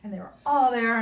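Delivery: ordinary talk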